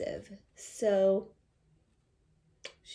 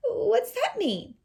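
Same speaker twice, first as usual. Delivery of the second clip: put-on little girl; 14 semitones higher, 5 dB louder, slower than usual. Girl asks word meaning.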